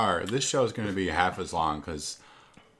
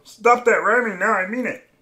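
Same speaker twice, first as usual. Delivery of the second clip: high, loud mock scold; 13 semitones higher, 11 dB louder than usual.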